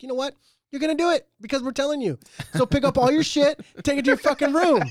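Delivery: high, loud fed-up dad shouting orders; dad retelling yelling at his daughter; retold yell. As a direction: bark it at a dawdling kid.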